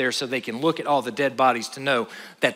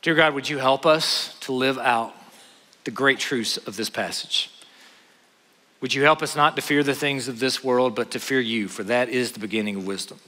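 closing prayer; 4 semitones lower than usual. Acted. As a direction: pray it gently for the whole room.

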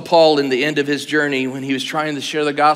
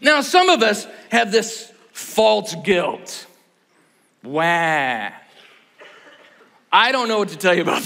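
ordinary talk

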